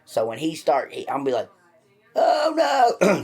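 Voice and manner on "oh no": mock panic. Pretend cellmate attack scream.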